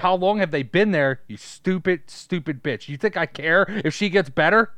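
over-the-top angry yelling at the woman; mock angry rant. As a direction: rant like a greedy relative who only cares about toys. Voicing greedy character's rant.

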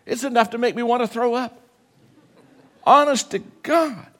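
building fervor, high and fast; fired-up preaching. Real, not acted. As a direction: preach it with rising urgency. Preacher ramps up, pitch soaring.

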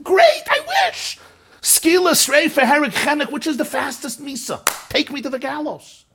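frantic sarcastic wail from a hurting youngster; bitter desperate cry. Retold or imitated. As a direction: voice a broken child begging for death. Child answering talk of death penalties.

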